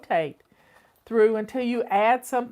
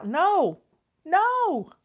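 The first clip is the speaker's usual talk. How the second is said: emphatic no; 8 semitones higher than usual. Real, not acted. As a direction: shoot the idea down hard. Rejects meal prepping idea.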